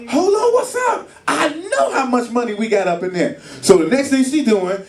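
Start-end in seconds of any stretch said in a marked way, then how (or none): 0.1-3.4 much higher, disbelieving mock cry; wife's shocked reaction. Wife at grocery store finding card declined, shocked higher voice. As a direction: play a wife stunned at the checkout, voice jumping up. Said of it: "You like".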